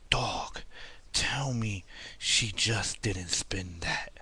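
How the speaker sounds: slow, drawn-out echo of Booker T's line; imitating Booker T. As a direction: stretch every word out like Booker T savoring it. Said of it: "he was like".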